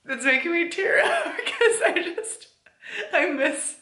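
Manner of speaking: laughing through tears; choked up but giggling; teary laugh. Real, not acted. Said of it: "I'm trying really hard not to cry".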